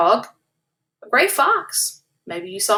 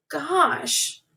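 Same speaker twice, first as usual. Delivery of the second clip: sighing; 5 semitones higher than usual. A narrator's reading. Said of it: "she sighed".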